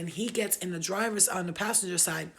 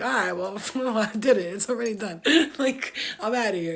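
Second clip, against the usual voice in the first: laughing through the words; chuckling, amused and dismissive; 3 semitones higher, 7 dB louder than usual. Retold or imitated. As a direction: giggle while brushing him off. Laughing off the brother.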